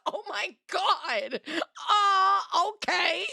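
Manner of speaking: stunned giggling okay; laughing disbelief. Real, not acted. Reacting to gun law remark.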